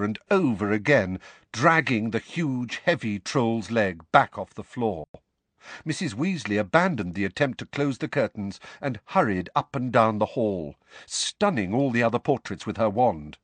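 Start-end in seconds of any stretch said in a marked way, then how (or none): none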